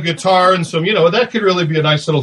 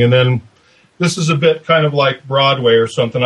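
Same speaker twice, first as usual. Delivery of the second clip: ordinary talk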